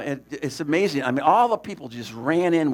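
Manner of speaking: ordinary talk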